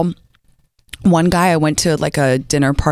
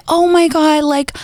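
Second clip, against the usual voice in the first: much higher, gushing over-sweet hello; fake sweet greeting; 10 semitones higher, slower than usual. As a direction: kill her with kindness, pour on the sugar. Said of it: "kill her with kindness".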